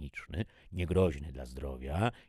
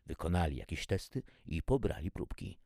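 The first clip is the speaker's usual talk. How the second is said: usual speaking voice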